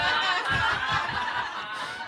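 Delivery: breathy burst of laughter; laughing. Real, not acted. Audience-facing laugh at own story.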